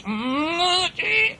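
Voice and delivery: high, rising engine whistle; imitated train toot. A narrator's reading. Little blue engine sounds her alarm, high imitated toot. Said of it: "She makes a very loud toot".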